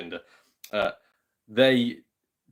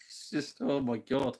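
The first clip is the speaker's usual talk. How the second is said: ordinary talk, nothing marked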